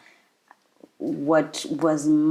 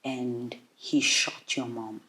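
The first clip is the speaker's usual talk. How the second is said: very soft, low retelling; quiet and low, with heavy pauses; breaking bad news; 5 semitones lower, 10 dB quieter than usual. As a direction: break it gently, in a hushed low voice.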